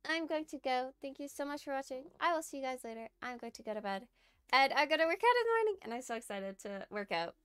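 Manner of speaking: loud, bright goodbye to chat; hyped stream sign-off. Real, not acted. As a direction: wrap up the stream with energy.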